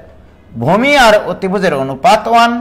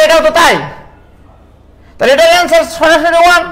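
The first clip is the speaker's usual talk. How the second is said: exasperated scolding; 5 semitones higher than usual. Real, not acted. Scolds students over silly question.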